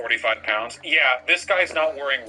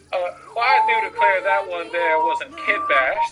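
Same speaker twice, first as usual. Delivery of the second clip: louder, lightly Southern Belle voice; 5 semitones higher, 4 dB louder than usual. Retold or imitated. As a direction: ham up a Southern lady declaring. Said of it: "We were doing a Southern Belle".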